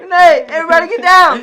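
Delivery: grenade warning yell. Pretend. Playful grenade alarm among friends.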